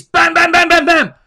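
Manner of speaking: high, loud popping imitation; mock rapid-fire bangs. Debater mocking an instant miracle birth, loud sound effect. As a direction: fire each one off like a burst.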